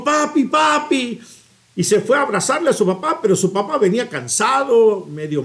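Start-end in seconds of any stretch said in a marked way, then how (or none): 0.0-1.2 put-on higher, slow child's call of "Papi, papi"; little boy calling dad. Preacher voices a boy greeting his tired father, eagerly.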